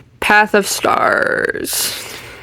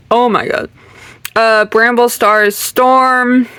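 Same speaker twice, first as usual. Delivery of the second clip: ordinary talk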